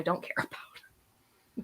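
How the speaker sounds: muttered whisper with a laugh; whispered aside. Real, not acted. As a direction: whisper it half to yourself, amused.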